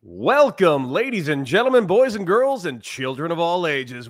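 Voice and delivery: booming circus announcer welcome; ringmaster. Acted. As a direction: call it out like a big top ringmaster. Mock circus-style show opening.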